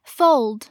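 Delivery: "fold" with a dark, blurred L before the d. Shown as a demonstration. Teacher giving learners an example word, dark L in "fold". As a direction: say "fold" as a model, darkening the L. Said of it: "L音要读成模糊舌边音".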